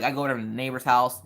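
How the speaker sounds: ordinary talk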